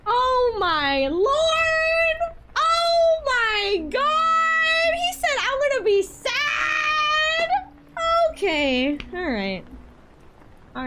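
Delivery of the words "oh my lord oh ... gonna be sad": high, loud, drawn-out cry; mock wailing. Streamer reacting to a viewer predicting sadness, mock wailing. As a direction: wail it out, stretching the last words.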